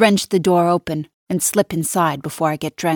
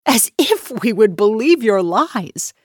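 higher, louder contemptuous sneer; 5 semitones higher, 4 dB louder than usual. Acted. Nina sneering at the maid, higher and louder. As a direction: sneer the words down at her. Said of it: "she sneers at me".

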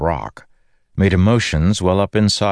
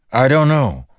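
high, raised reply; shouting; 9 semitones higher, 4 dB louder than usual. A narrator's reading. Dalinar answering the Windrunner mid-flight, shouting. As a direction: shout it back over the wind. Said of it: "Dalinar shouted".

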